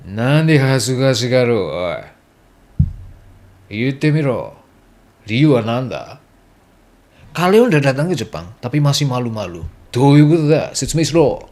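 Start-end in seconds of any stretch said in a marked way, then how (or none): 0.1-6.2 a mock rough local demanding an answer, slowed and put-on; gruff Japanese challenge. Rough local teasing a shy newcomer, gruff challenge. 9.9-11.4 loud put-on demand for an explanation; mock angry demand. Pushy local yells at a shy foreigner, loud mock demand.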